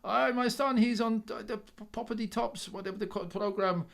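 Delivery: put-on high, lively Polish dad accent; proud dad in a Polish accent. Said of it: "I keep doing the Polish accent".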